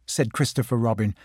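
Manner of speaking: plain reading voice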